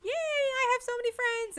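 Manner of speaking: be a dog thrilled by new friends; happy dog. Retold or imitated. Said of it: "And the dog's like".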